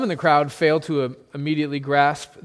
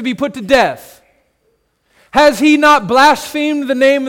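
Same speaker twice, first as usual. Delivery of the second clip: zealous recitation; 5 semitones higher, 7 dB louder than usual. Acted. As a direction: declaim the law like a zealot. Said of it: "begin to recite the words of Leviticus".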